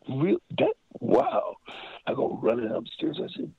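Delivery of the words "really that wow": a startled jump up in pitch; amazed disbelief. Retold or imitated. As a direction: gasp it out like you can't believe it. Stunned that [name] is calling.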